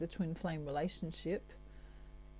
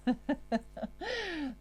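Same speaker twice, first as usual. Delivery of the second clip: high burst of laughter at her bad accent; laughing at her own accent; 9 semitones higher than usual. Real, not acted. Tarot reader laughing at her own accent, high giggle. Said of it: "That's a really bad accent".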